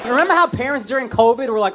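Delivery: ordinary talk, nothing marked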